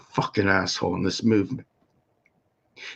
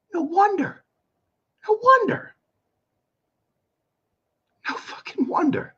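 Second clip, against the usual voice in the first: very high falsetto disbelief; strained, sky-high incredulous voice; exasperated squeak. Real, not acted. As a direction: squeal it out, fed up. Exasperated climax of rant.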